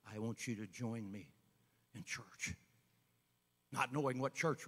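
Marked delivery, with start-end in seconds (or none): 0.1-2.5 low, soft voice from heaven; a hint of God's voice. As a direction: speak softly, like a voice from above. Voice from heaven speaks.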